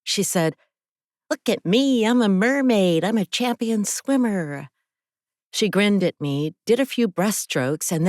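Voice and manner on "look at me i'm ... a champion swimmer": higher, lilting show-off call; playful boasting. A narrator's reading. Girl showing off to her friend in the river, playful boasting.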